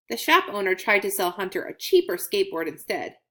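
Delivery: ordinary delivery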